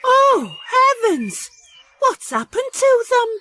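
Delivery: horrified cry of dismay; shocked. Acted. Dorothy finds her carrots trampled, high shocked cry.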